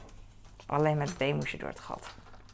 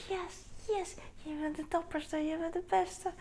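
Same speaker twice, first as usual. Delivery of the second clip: high, soft, beaming cheering; excited praise; 11 semitones higher, 7 dB quieter than usual. Retold or imitated. Mother praising her son as the bravest, soft high cheer. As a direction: gush over him as the bravest.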